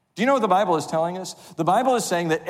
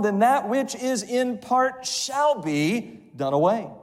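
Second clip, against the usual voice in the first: scripture reading; 4 semitones higher than usual. Acted. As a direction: lift the verses like a preacher. Reads scripture in preaching voice.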